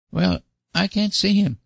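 put-on high boyish protest; objecting little boy. A narrator's reading. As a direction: push back like a stubborn child. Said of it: "objected Davy".

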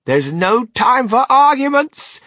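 high, loud, stretched-out command; ringing, high-pitched insistence; urgent cry. A narrator's reading. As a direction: cut off all objection with a big cry. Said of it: "cried Mister Wonka".

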